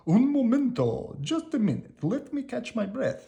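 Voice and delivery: Big Anthony mobbed by ladies, pleading in a high panic; cried out scared. A narrator's reading. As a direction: beg for a breather, flustered and scared. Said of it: "he cried".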